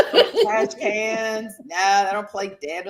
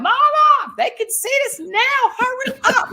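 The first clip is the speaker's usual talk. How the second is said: high, loud holler across a road; re-enacted urgent shout; retold yell; 5 dB louder than usual. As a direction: act out calling to mama from afar. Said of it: "went to the edge of the road and yelled".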